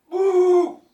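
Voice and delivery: high, loud howling whoop; animal howl. Shown as a demonstration. Man doing funny animal noises, loud high howl. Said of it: "F A N means funny animal noises".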